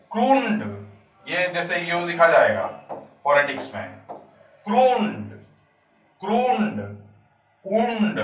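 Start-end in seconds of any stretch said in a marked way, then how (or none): 0.1-0.6 क्रून्ड with a high, stretched oo before ड; long oo demonstration. Shown as a demonstration. Teacher showing the vowel sign, sounded out. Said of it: "यहां पर ऊ की मात्रा है". 4.7-8.2 क्रून्ड, उंड with a high, held oo; longer sound. Shown as a demonstration. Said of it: "और ये longer sound है".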